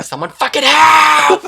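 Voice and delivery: high, loud, slow shrieking plea; mock panicked shout. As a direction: shriek for help as if the ceiling is caving in. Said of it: "That's how she said it".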